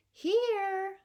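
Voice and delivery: high, loud, held-out call; piping call. Acted. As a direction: pipe it out so they notice. Invisible boy answers more insistently.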